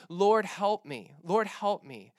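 mild mock cry for help, repeated; retold crying out. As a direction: call out a drawn-out plea, stopping short of a shriek. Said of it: "She's shrieking".

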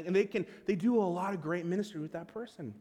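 normal talking voice

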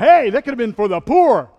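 loud, high, hammed-up self-righteous whine; mock sanctimonious protest. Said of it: "So I'm like".

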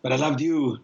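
hammed-up melodramatic declaration; mock soap opera drama. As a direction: play it like a soap star.

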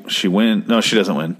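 ordinary delivery

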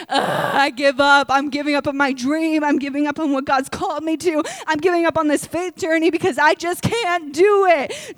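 hammed-up defeat, rising at the end; mock giving up. Acting out someone who gives up.

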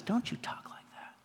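whispered, urgent scolding; hushed rebuke. Acted. Peter rebuking Jesus, in an urgent whispered scold.

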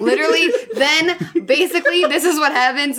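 words breaking into real laughter; laughing while talking. Real, not acted. Recapping the silly movie, laughing through it.

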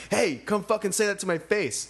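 put-on high, confrontational girl calling out; acted angry challenge. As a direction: imitate someone demanding a fight. Retold angry girl confronts car.

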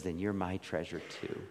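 a hushed answer from the heart; nearly whispered devotion, but voiced; heart's reply. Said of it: "my heart responds and says".

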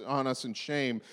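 ordinary delivery

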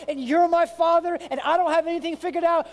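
high, loud, impassioned plea to God; welling-up cry. Acted. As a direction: cry out like a helpless child to a father. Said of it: "Is there a cry welling up inside".

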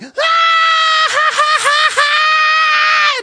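very high, loud shrieking laugh; scream-laugh as if unable to stop; imitated uncontrollable laughter. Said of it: "just uncontrollably".

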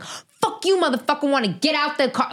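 high, furious cursing at a driver; crazy rage yell. Retold or imitated. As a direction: unload on him like a maniac. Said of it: "like, crazy".

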